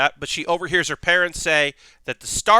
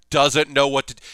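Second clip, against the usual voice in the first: ordinary talk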